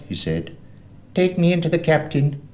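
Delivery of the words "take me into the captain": high, loud, cold order; cruel blind beggar. A narrator's reading. Blind man orders boy inside, cruel high command. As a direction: command it coldly on a high pitch. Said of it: "a voice so cruel and cold and ugly".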